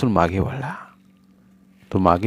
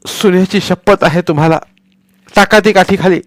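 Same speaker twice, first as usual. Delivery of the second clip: desperate plea; 9 semitones higher, 6 dB louder than usual. Acted. Wife begs husband stop.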